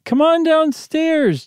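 slow, high, lightly wavering call up the stairs. Acted. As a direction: call the kids high and unsteady. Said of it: "Janet called in a wavering voice".

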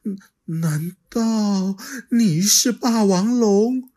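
put-on high, childlike dinosaur voice, puzzled; little dinosaur voice. Little Xiaoliang asking the T-rex, high and puzzled. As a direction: play the little dino, wide-eyed and unsure.

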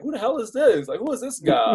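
incredulous. Retold or imitated. Reporters eyeing the newcomer at the scene, incredulous and dismissive. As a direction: voice the reporters sizing up a stranger. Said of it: "they're looking at me like".

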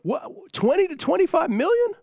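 very high-pitched disbelief; incredulous. Real, not acted. Host reacting to a coach's salary demand, incredulous. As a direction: balk at the figure, voice shooting way up.

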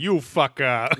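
mock Hawaiian pidgin; Hawaiian pidgin accent. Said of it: "they all just say".

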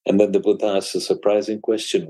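ordinary talk, nothing marked

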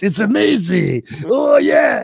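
a Frenchman shouting how much he loves her show; excited French accent. Retold or imitated. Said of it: "This is actually how Remy sounds".

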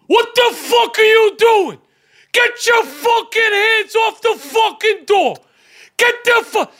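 high furious shouting, slow and hammered; Aiello impression. Aiello on the plane yelling at the door grabber, furious shouting. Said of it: "what would have Aiello said?".

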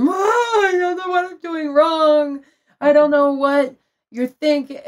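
high, loud re-enacted tearful wail; mock wailing. Retelling crying alone in the locked bathroom, mock wailing.

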